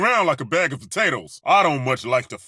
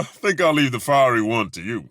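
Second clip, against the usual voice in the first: ordinary talk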